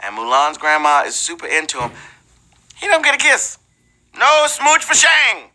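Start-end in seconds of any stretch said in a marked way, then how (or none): 2.8-5.5 teasing. Acted.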